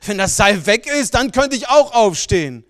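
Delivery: father suddenly understanding; loud realisation. Acted. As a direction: blurt it out as it dawns.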